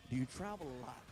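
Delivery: low-pitched imitation of a doctor; deep clinical question. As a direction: ask it deep and serious, as the doctor. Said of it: "And then he asks".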